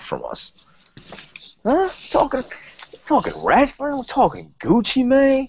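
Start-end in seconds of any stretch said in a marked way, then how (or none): 1.6-5.5 mock high voice. Mocking high rapper chant.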